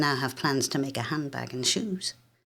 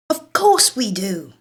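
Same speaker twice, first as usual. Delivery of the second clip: put-on high, loud, slow outraged girl; 5 semitones higher, 6 dB louder, slower than usual. A narrator's reading. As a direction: scold her little sister's stupidity. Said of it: "She's outraged by my lack of sense".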